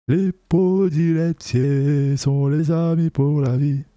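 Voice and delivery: slow robotic autotuned singing; autotune. Shown as a demonstration. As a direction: sing it through autotune. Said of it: "je vais mettre un peu l'autotune".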